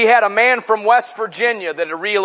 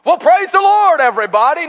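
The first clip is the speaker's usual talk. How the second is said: higher, louder song-leader call in a West Virginia drawl; 11 semitones higher, 6 dB louder than usual. Retold or imitated. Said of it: "in that West Virginia drawl".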